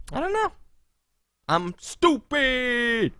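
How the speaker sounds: high, slow playing dumb; mock dim-witted voice. Posing as the idiot, high slow dim voice. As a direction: sound slow and dim on purpose.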